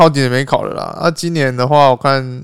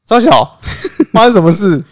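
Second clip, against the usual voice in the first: shocked outburst; 6 semitones higher, 4 dB louder than usual. Retold or imitated. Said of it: "我说".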